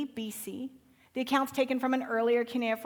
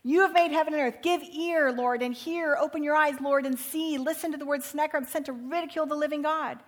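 fervent prayer; 4 semitones higher, 6 dB louder than usual. Acted. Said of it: "and goes".

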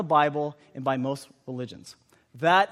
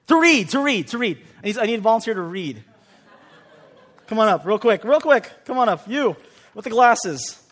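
animal show trainer; 5 semitones higher, 9 dB louder than usual. Real, not acted. Calling a volunteer up on stage.

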